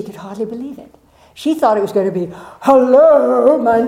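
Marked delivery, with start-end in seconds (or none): 2.6-3.8 louder, a big man's booming hello. Retold or imitated. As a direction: boom it out like a huge guy. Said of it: "that big".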